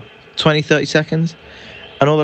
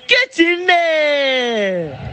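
high, long-stretched celebration; triumphant goal cry; 12 semitones higher, much slower than usual. Real, not acted. Fan celebrating going one nil up, high triumphant shout. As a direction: belt out the scoreline like a jubilant fan.

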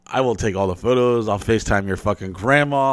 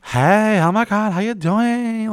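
high, gushing waitress greeting; mock bubbly waitress, much higher; 11 semitones higher, slower than usual.

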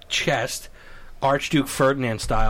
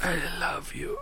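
whispery, hammed-up tenderness; weak, soft mock blessing; frail pope; 8 dB quieter than usual. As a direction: breathe it out like a dying pope. Weak pope forgiving his attacker.

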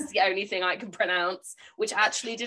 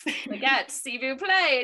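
slow, careful put-on French; halting tourist French; mock French accent; 3 semitones higher, slower than usual. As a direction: try your best French for the order. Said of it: "I was like".

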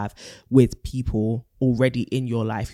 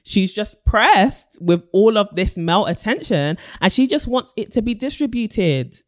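put-on American accent; 7 semitones higher, 3 dB louder than usual. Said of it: "I'm only going to get worse".

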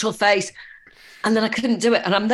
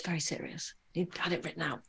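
hushed aside; 2 semitones lower, 15 dB quieter than usual. Real, not acted. Aside describing the child's solemn speech.

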